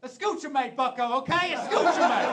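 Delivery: put-on loud, high Southern accent mangling Spanish words; bad Spanish from a Southern teacher. Comic imitating the Southern teacher faking Spanish at his class, loud Southern accent. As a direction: bark broken Spanish like a gringo teacher. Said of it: "would speak Spanish even though he couldn't".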